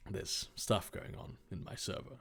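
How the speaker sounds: ordinary delivery, nothing marked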